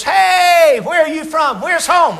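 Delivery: high, stretched "hey" and questions; friendly calling out. Acted. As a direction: hail a stranger from a distance. Stranger calling out to a traveller.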